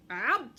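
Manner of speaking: sharp loud yelp; loud ouch. Acted. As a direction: yelp at the hot stove. Badger burns paw.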